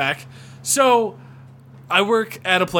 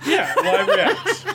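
laughing; much slower than usual. Real, not acted. Laughing at joke.

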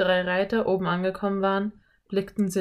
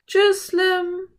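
put-on high, loud girlish farewell; calling after him; 13 semitones higher, 6 dB louder than usual. A narrator's reading. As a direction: call goodbye after the departing rider. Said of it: "rief Anne ihm nach".

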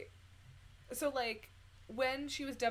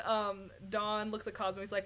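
ordinary talk

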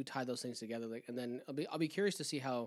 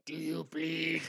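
husky whispered Batman voice; menacing superhero whisper, hammed up; mock Batman; much slower than usual. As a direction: growl it in a stagey whisper. Mocks Ben Affleck's bad Batman.

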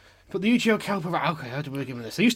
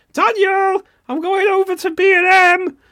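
much higher, much louder call across a room; mum calling out; 12 dB louder, slower than usual. Retold or imitated. Mother calls her son across the bookshop floor, loud mock call. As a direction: imitate a mother shouting for her son from far away.

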